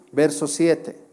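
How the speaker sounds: usual speaking voice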